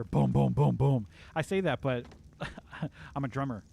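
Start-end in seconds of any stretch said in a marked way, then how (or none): 0.0-1.1 loud, even drum booms; imitated drum thumps. Streamer complains about drumming in his ear, imitated booms. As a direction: mimic drums pounding in the ear. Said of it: "it's just in my ear".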